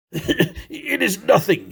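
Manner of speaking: slow words forced out between coughs, a laugh caught in them; wheezing after a coughing fit. Acted. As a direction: choke out a brave dismissal after coughing. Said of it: "found it impossible to reply for many minutes".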